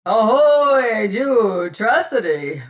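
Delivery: very loud hailing roar. Acted. Godby answering Tressady, roaring. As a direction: roar the reply across the water. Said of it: "roared Godby in reply".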